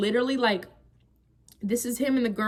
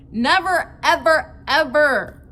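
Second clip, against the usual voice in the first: each word punched loud and higher, separated; advising women never to take a cheater back, stress on each word; emphatic never ever; 4 semitones higher, 8 dB louder, slower than usual. Acted. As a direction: hammer Never, ever, ever one by one. Advice to viewers, emphatic.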